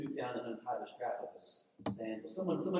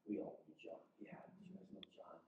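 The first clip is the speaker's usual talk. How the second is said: hushed, breathy murmur; whisper; 9 semitones lower, 18 dB quieter, faster than usual. Acted. Preacher voicing the crowd whispering about John. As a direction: let it slip out under the breath.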